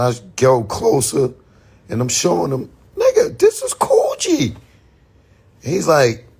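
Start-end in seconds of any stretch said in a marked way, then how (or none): 3.0-4.6 high cracking cry of disbelief; cracking excited cry. Retold or imitated. Showing Jay the jacket, a high cracking excited cry. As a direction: let the voice crack way up, amazed.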